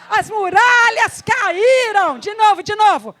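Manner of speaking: high, loud, slow triumphant shout; shouted proclamation. Real, not acted. Preacher shouts the walls falling with the congregation. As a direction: proclaim it with full force.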